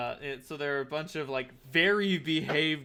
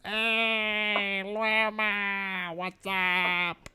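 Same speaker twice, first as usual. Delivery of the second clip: goblin voice; 5 semitones higher, much slower than usual. Acted. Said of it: "And the goblin says".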